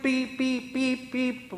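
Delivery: high beep beep sounds; mock bleeping. Coworkers' swearing bleeped out, high mock beeps. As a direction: imitate a censor bleep, pitched high. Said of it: "just bleep".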